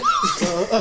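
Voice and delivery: very high burst of laughter; sudden laugh. Real, not acted. Host cracking up at his cohost's remark.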